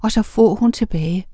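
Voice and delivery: ordinary delivery, nothing marked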